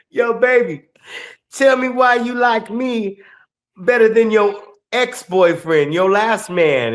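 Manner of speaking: a hint of a crooning boyfriend; guy sweet-talking. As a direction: lightly play the smooth boyfriend. Guy in reel sweet-talking girlfriend.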